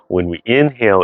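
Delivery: usual speaking voice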